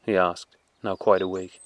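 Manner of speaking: usual speaking voice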